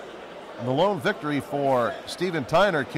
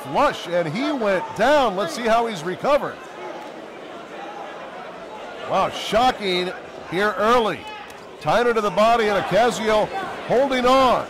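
excited commentary; 6 semitones higher than usual. Real, not acted. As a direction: call the knockdown like a shock. Knockdown in the fight.

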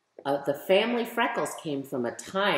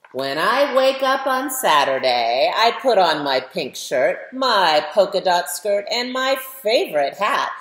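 louder, lively picture-book reading voice; reading to children; 5 semitones higher, 6 dB louder than usual. Acted. Author reading her book to schoolchildren, lively story-time voice. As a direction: read it like story time for a class of kids.

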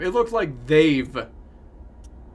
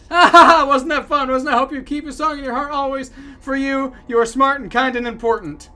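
loud fake laugh into high chirpy praise; manically cheerful mascot voice; happy buddy; 6 semitones higher, 6 dB louder than usual. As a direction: gush in a high, sugary voice. Voicing the game's happy buddy.

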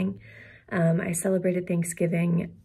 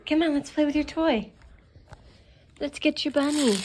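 excited dog talk; 8 semitones higher, 3 dB louder than usual. Real, not acted. Playing with the puppy.

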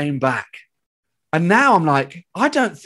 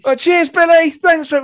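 high, loud retold thanks; calling back; 13 semitones higher, 6 dB louder than usual. Fans thanking a comedian from down the street, calling back.

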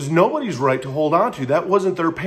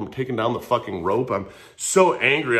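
ordinary talk

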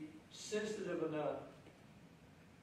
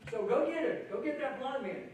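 eager order; 7 semitones higher than usual. Retold or imitated. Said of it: "disciples relaying command".

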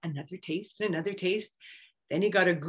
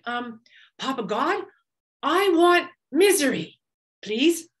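higher, louder, pleading monkey; eager Monkey voice; 5 semitones higher, 5 dB louder, slower than usual. A narrator's reading. Monkey begging Papa God, higher and eager. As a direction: beg sweetly for what he craves.

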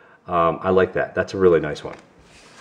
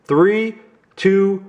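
ordinary talk, nothing marked

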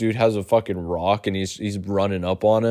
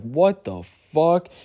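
stunned disbelief; 5 semitones higher, much slower than usual. Retold or imitated. Kids stunned by rock hitting door.

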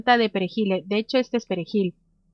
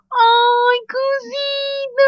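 cooing squeal; 13 semitones higher, 4 dB louder, much slower than usual. Acted. Cooing at gnomes offer.

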